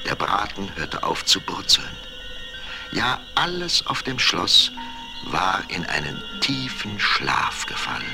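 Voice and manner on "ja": ordinary delivery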